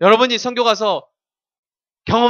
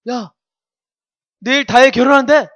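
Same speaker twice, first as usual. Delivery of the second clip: mock excited kid, higher and louder; hammed-up thrilled classmate spreading news; excited gossip; 6 semitones higher, 9 dB louder than usual. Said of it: "막 이렇게 하는 거야".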